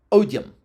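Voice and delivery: Odium squeezed into two syllables, OHD-yum. Shown as a demonstration. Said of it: "used to be pronounced as two syllables".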